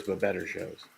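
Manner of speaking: ordinary talk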